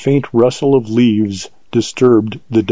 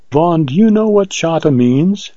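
lightly grave question in a higher voice; a touch of solemn weight, pitched up; 6 semitones higher than usual. A narrator's reading. Heroine questions the ranger about the pet name, mildly grave. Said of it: "she asked gravely".

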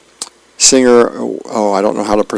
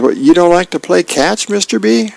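higher-pitched kid's question, lightly voiced; kid Elmo; 8 semitones higher than usual. A narrator's reading. Kid asking napping neighbor to play catch, higher questioning voice. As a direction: ask it up high like a puzzled kid. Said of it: "Elmo says".

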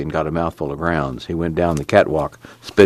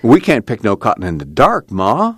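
Al protesting; 6 semitones higher than usual. A narrator's reading.

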